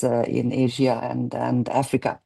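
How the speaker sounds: normal talking voice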